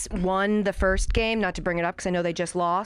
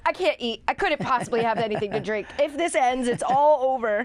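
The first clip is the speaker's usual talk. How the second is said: high, stressed fan's fretting; mock panic; 5 semitones higher, 3 dB louder than usual. Guest retelling game seven nerves, mock panicked. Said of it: "heart in your throat the whole time".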